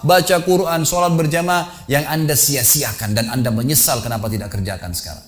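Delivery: high, drawn-out exhortation to a congregation; fervent preaching. Retold or imitated. (khotbah menggugah dengan nada tinggi)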